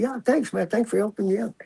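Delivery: butter him up with fake thanks; fake grateful. Officer fakes thanks to the inmate, high and soft.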